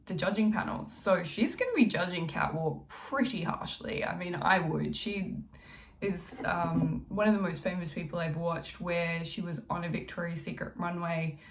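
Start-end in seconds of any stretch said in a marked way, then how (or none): none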